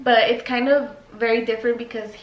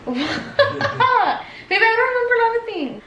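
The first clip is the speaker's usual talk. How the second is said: laughing; 11 semitones higher than usual. Real, not acted. Teasing partner, laughs while answering.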